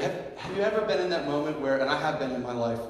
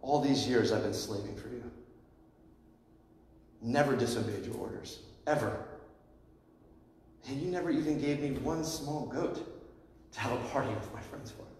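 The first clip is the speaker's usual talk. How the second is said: bitter complaint; 4 semitones lower, 7 dB quieter than usual. Retold or imitated. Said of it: "Look at how the older brother speaks".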